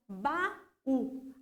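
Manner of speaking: baú slowly broken into ba and ú; split into syllables. Shown as a demonstration. Teacher counting syllables for pupils, baú slowly split in two. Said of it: "A separação em sílabas pra gente poder contar".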